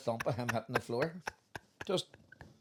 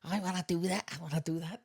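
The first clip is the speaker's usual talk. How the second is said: higher-pitched mock Birmingham accent; lilting Brummie accent impression; 4 semitones higher, 3 dB quieter than usual. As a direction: imitate an eager lad in a Birmingham accent. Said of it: "I remember that wee accent".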